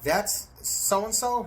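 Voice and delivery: stunned recognition of the snitch; shocked disbelief. Retold or imitated. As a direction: ask it as if you can't believe it. Learns cellmate is informant.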